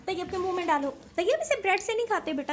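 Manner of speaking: scolding. Real, not acted. Mother scolds daughter while feeding.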